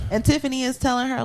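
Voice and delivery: ordinary talk, nothing marked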